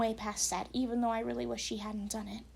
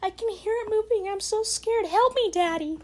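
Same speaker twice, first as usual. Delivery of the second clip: much higher, whiny daughter voice; mock the text in a squeaky voice; mocking girlish whine; 9 semitones higher, 5 dB louder than usual. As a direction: parody of a scared girl. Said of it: "it sounds so unnatural".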